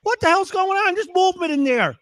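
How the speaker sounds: imitating a high-pitched alarmed woman; panicked woman. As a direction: squeal it like a shocked woman. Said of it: "and the woman goes".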